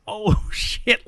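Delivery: high, drawn-out amazed reaction; mock shock. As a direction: act out stunned delight with a laugh. Teller repeats his shocked reaction.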